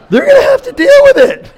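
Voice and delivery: high and loud through laughter; laughing mock complaint. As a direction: laugh while refusing to pay. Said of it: "I'm like".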